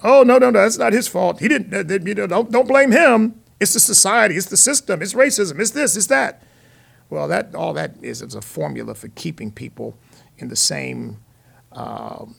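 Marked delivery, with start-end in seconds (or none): 0.0-6.4 high, loud imitation of protesting apologists; mock excuse-making. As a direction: rattle off excuses in an exaggerated, pleading protest. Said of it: "you're making excuses for people".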